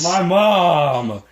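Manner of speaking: mock outrage. Guard lamenting his mother's honor, hammed-up outrage. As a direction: cry it in fake horror.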